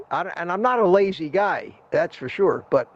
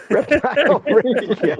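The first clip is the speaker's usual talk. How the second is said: laughing; 5 semitones higher, 4 dB louder, slower than usual. Real, not acted. Laughing at new nickname.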